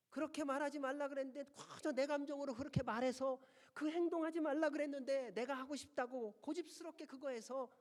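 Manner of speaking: loud, high, pressing sermon build; fervent preaching. Acted. Preacher listing the congregation's stubborn mistakes, loud and high. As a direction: drive the list of sins home, full voice.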